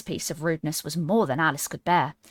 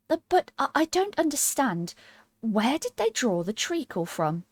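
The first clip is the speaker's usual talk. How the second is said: high, hesitant and careful; cautious; 7 semitones higher than usual. Acted. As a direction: tiptoe into the question. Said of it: "so she began very cautiously".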